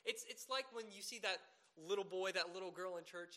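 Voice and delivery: ordinary talk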